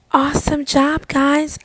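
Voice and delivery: proud, loud congratulations to the class; excited praise. Real, not acted. Teacher cheering students' improved scores.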